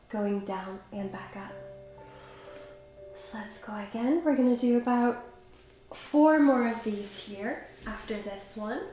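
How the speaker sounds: ordinary delivery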